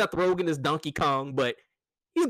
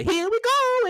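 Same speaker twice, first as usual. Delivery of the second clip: high, louder Mario "here we go"; Mario catchphrase; 5 dB louder than usual. Retold or imitated. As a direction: chirp it like Mario would. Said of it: "the little intangibles that Mario does".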